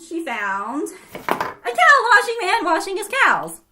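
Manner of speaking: usual speaking voice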